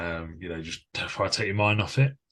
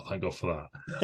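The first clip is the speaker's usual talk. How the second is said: much softer, laughing relief; soft laughing thank God; relieved laugh; 2 semitones lower, 9 dB quieter than usual. Retold or imitated. Relieved cameras were gone.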